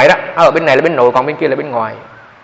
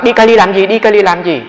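higher, louder anxious questioning; voicing the worried wife, pitched up; alarmed wife; 5 semitones higher, 5 dB louder than usual. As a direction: press him for an answer in a panic. Wife asks again at the door.

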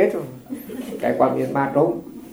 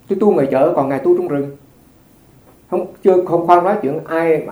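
usual speaking voice

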